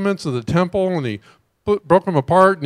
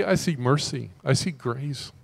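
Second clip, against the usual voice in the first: ordinary talk, nothing marked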